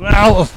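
sharp pained yelp; a sudden loud ow. Real, not acted. Sudden pain in the dark.

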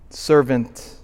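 normal talking voice

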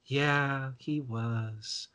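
softly trailing, absent-minded agreement; distracted. Distracted friend half-answering while not paying attention, absently. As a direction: answer with your mind elsewhere. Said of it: "she's not paying attention".

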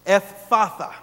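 Ephphatha said deliberately, both F sounds held; hard double F. Shown as a demonstration. Preacher repeating a difficult Aramaic word for listeners, hard double F. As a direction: lean hard on the two Fs in Ephphatha. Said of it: "Putting two F sounds".

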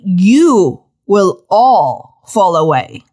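very slow, drawn-out repeat; every word of "fall away" stretched slowly. Shown as a demonstration. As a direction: spell out "you will all fall away" word by word. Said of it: "Please say it slowly".

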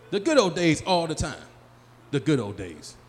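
usual speaking voice

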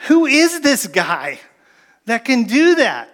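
high, slow, astonished question; awed amazement. Retold or imitated. Preacher voicing the disciples' awe at calmed storm, high and slow. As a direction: marvel at him, dragging out the words.

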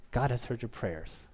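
soft, low reflective line; hushed repetition. Acted. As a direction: let it sink in quietly. Preacher repeats the line softly.

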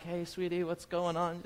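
put-on soft, tender hush to a crying child; gentle comfort. Uncle soothing his crying niece in the corner, soft and tender. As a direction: coax her softly like a kind uncle.

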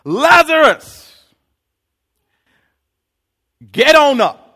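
long, high booming call to the tomb; loud call. Acted. Jesus calling into Lazarus' tomb, booming loud call. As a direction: call him out of the grave at full voice. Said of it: "Jesus called out in a loud voice".

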